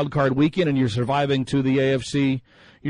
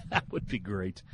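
ordinary talk

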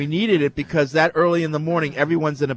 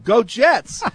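higher-pitched mock fan cheer; retold yell; 6 semitones higher, 3 dB louder than usual. Waitress joins in for her own team, mock yell. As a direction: imitate the waitress yelling it back. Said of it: "she starts to yell out".

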